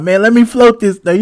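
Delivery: high, eager begging; eager pleading. Retold or imitated. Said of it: "I'm like".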